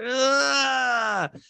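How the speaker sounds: long, high-pitched groan of dismay; drawn-out mock-tearful groan; exasperated groan. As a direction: wail out an exaggerated groan. Mock disgusted reaction.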